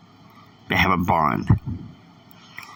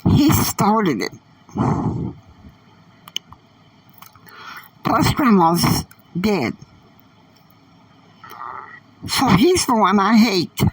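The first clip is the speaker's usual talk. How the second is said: put-on much higher teen girl voice, confiding; 10 semitones higher than usual. A narrator's reading. Annie telling Catalina about her mother, high girlish voice. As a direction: pitch up and tell it like a young girl.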